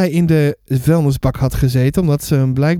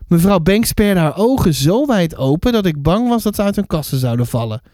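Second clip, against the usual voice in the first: ordinary delivery, nothing marked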